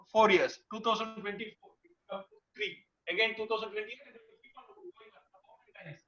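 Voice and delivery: faint, dropping out of hearing; barely audible. Real, not acted. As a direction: voice fading to almost nothing. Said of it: "your voice is very low".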